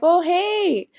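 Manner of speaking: high, loud, delighted hello; imitated friendly greeting. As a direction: mimic an exuberant greeting. Star greets fan, retold.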